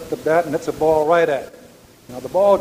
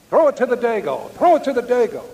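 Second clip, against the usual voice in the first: higher-pitched mock yell, repeated twice; retold catcher's yell; 7 semitones higher than usual. Catcher yelling to Gomez where to throw, in a higher mock yell. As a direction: call it out like the catcher across the field. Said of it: "So he yells".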